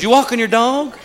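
dopey neighbor. Retold or imitated. Neighbor asking about the dog walk, dopey voice. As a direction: play the clueless neighbor.

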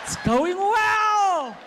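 high, slow stretched crow; drawn-out boasting. Acted. Comic bragging that it is going well, high drawn-out crow. Acts gloating over success.

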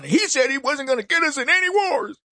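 high-pitched mimicry of aggrieved voters; mock complaint. Mimicking complaining former party supporters.

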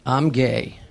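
low, soft confiding sergeant. Acted. Sergeant propositions the scared soldier, low confiding voice. Sergeant's line quoted by the son.

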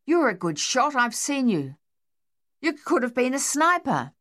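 high, raw panicked rant; shell-shocked soldier unravelling; frantic Australian soldier. Acted. Harold grabbing Dave.

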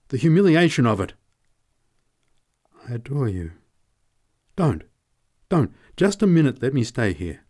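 high, loud, anguished; desperate lover's plea; desperate pleading. A narrator's reading. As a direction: beg her with breaking passion. Man pleading desperate love.

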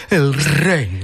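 lightly voiced, drawn-out presenter; Gloria Serra. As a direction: pull the words back like the reporter. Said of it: "habla para atrás".

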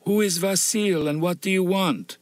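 high, wary sick woman; a frail widow demanding answers; suspicious widow. A narrator's reading. Widow questions the stranger.

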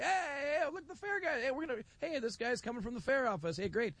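high, eager hey-there calls to the official; excited greeting. Retold or imitated. Radio host hails the fair official with eager high calls.

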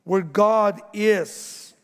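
ordinary talk, nothing marked

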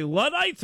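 "Luddites" said first with a short U, then "Luddites" with a long OO; alternative pronunciations. Shown as a demonstration. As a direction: contrast short-U and OO vowels in "Luddites". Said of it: "I call 'em Luddites, but it's probably Luddites".

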